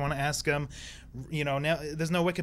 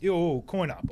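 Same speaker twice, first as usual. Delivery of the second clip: Brooklyn accent; slower than usual. Shown as a demonstration. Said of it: "how would you say coin op in Brooklyn".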